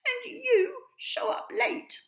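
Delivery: very high, loud little bird voice; a small sparrow with a big worry; chirpy sparrow. A narrator's reading. Sue scolds the latecomer.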